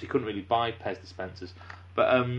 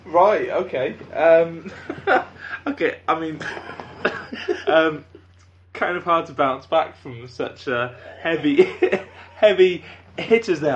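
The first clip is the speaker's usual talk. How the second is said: real laughter breaking through, louder; cracking up while trying to carry on; can't stop laughing mid-sentence; 5 semitones higher, 8 dB louder than usual. Real, not acted. Host cracks up at dark joke.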